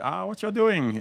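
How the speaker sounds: high, mock broken-English accent, put on lightly. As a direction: play a traveller faking an accent to be understood. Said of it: "broken English".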